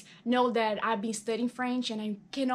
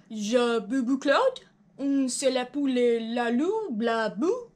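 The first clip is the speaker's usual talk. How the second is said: confident nonsense French, slow and louder; fake French gibberish; 3 dB louder, slower than usual. Joey auditioning, faking French gibberish boldly. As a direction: bluff through pretend French with full confidence. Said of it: "Joey fakes French at audition".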